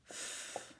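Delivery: whispered sounding out. Shown as a demonstration. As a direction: breathe the sounds F-oo-d silently.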